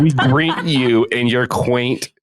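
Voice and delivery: slow, high mock robot voice; stilted sci-fi announcer voice; old speech synthesizer. Continues futuristic alien bit.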